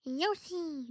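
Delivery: a quick Yoshi call; Yoshi impression. Shown as a demonstration. Vlogger attempting a Yoshi impression for viewers. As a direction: chirp the name like Yoshi. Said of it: "can I do a Yoshi impression?".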